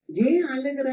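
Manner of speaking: high-pitched question lifting at the end. Acted.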